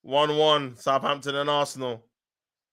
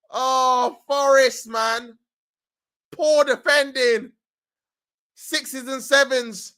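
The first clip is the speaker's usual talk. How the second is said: raised, animated commentary; live play-by-play; 7 semitones higher, slower than usual. Real, not acted. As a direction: call the replay with urgency.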